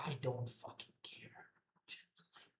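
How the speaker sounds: unvoiced whisper, fading out on genuinely; whispered aside. Real, not acted. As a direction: drop to a whisper, then trail off to nothing. Muttered aside to chat.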